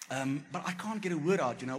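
ordinary delivery

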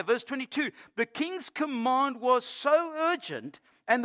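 high, loud, ringing pulpit reading; forceful scripture reading. Real, not acted. Preacher reading of three men thrown into fire, forceful scripture reading. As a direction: proclaim each phrase with mounting drama.

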